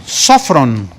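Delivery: sofrón with the stress on the first syllable, SÓ-fron; sofrón stressed on the first o, falling. Shown as a demonstration. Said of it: "Tiene acento en la primera O".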